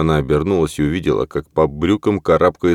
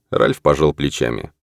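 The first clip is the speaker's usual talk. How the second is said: ordinary delivery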